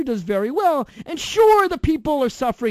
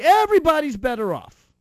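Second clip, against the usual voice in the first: mock cheerful; 9 semitones higher, 7 dB louder than usual. Real, not acted. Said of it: "repeated punchline".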